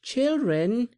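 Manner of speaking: high, loud call into the wood. A narrator's reading. Blind woman calling for the children in the wood. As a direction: call across the trees, searching. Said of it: "the blind woman crying".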